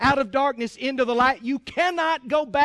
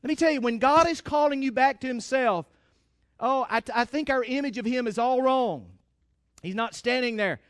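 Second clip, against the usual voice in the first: ordinary talk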